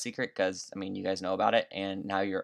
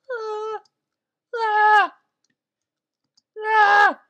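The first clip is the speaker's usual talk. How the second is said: high, loud short screams; scared screams; 10 dB louder, much slower than usual. Real, not acted. Gamer screaming as zombies attack. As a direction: shriek in fright.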